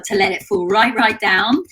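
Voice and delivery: usual speaking voice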